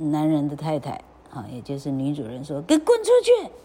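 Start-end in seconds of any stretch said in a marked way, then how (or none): 2.6-3.5 high, loud hammed-up yell; angry shout. A narrator's reading. Mistress to the duckling, shouting angrily. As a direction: play a furious mistress.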